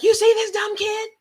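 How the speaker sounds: mock a stern grandmother; imitating grandmother. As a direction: grandmother warning his dad, high scolding. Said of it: "And so she's like".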